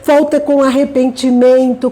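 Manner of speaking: usual speaking voice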